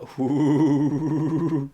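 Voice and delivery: long wavering "hooo"; mock ghostly hoot; spooky wail. Streamer teasing about some weird thing, mock spooky wail.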